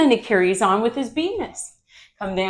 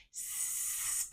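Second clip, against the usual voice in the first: long voiceless hiss; snake hiss; 23 dB quieter than usual. Shown as a demonstration. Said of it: "let out a hissing sound".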